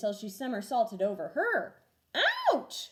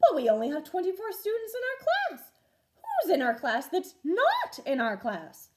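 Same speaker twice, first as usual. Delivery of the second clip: lightly voiced confused teacher; puzzled teacher; 8 semitones higher, 6 dB louder than usual. A narrator's reading. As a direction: work out the riddle aloud. Teacher puzzling over headcount.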